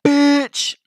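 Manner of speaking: loud high shout; yelled insult. Retold or imitated. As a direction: yell it at a passing car.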